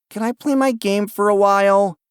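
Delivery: put-on high, pleading child voice; little boy voice. A narrator's reading. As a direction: ask like a hopeful little boy. Child asks to play game.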